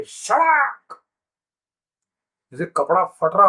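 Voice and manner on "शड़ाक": high, sharp "shraak" of a bursting shell; one sudden high-pitched burst, like cloth tearing; imitated shell screech. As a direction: mimic the ripping crack of artillery. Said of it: "तो आवाज आती है".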